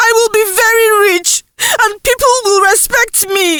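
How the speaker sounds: teary voice. Acted. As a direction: sob out a defiant promise. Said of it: "in a teary voice".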